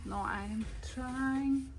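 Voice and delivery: ordinary talk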